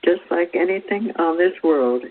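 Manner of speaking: normal talking voice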